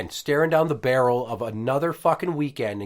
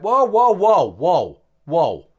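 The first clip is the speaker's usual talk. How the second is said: calming whoa; 6 semitones higher, 10 dB louder, much slower than usual. Retold or imitated. Calming crying daughter.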